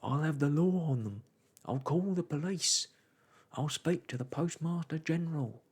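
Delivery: loud, high indignant threats; crying loudly. A narrator's reading. Postman threatening as he tumbles downstairs, loud and indignant. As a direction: bluster it out, outraged. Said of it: "crying loudly".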